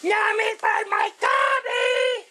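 high, slow squeaky little-worm shout. Retold or imitated. Vlogger imitating a worm's catchphrase, high squeaky shout. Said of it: "I would do one of them worm".

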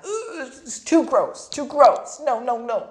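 put-on high, loud grossed-out child; disgusted boy. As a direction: recoil from the bowls. Arthur refusing gross bowls.

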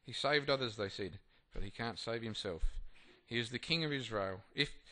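formal reading voice; scripture reading. Acted. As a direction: read the passage out to the group. Reads scripture aloud.

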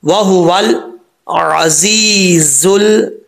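slow Quran reading with long held vowels; careful recitation. Shown as a demonstration. Teacher putting the phrase together, slow careful recitation. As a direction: recite it measured, stretching the final word.

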